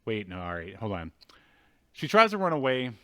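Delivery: ordinary talk, nothing marked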